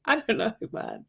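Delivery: usual speaking voice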